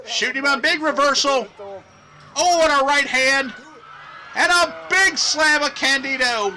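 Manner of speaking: loud, high wrestling call; excited play-by-play. Acted. Wrestling announcer calling a slam and near falls, excited shouting. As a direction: call the big moves at a shout.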